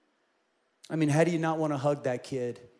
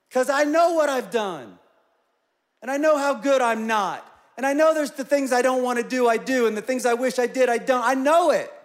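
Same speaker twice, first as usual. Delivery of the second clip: raised, louder protest, higher pitched; exasperated self-reproach; 8 semitones higher, 7 dB louder than usual. Retold or imitated. Man protesting to his counselor about himself, exasperated. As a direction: plead it out in frustration, voice climbing. Acting out own anguished self-argument.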